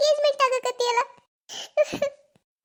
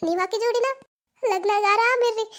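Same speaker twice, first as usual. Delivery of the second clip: normal talking voice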